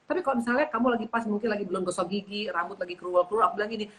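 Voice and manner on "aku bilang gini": normal talking voice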